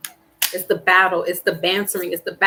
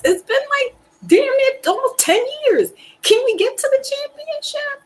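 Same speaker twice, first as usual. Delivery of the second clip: high exasperated whine. Real, not acted. Fed-up fan whining at his team, high pleading whine. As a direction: pitch jumps way up into a pleading whine. Whining rant about championship drought.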